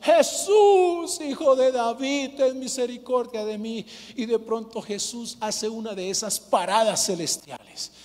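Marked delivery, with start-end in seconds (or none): 0.0-3.8 loud, high pleading cry, slowed on "ten misericordia"; desperate shout. Acted. Blind Bartimeo crying out to Jesus, desperate loud shout. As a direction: cry out to be heard over the crowd. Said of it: "No grites tanto".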